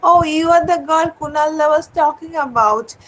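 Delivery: ordinary talk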